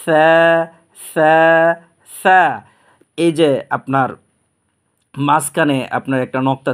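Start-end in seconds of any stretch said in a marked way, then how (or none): 0.1-2.6 সা soft and thin, louder than his talk, one beat long. Shown as a demonstration. Teacher to students, soft thin সা. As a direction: drill a soft lisped সা three times. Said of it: "নরম আওয়াজে, পাতলা আওয়াজে পড়ব".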